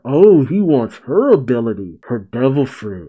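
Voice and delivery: ordinary delivery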